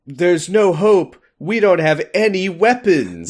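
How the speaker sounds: loud, high, hammed-up despair; panicked mock yelling. Robert despairing at having no weapons, hammed-up yell. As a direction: wail it out like a doomed man. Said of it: "everyone's yelling over each other".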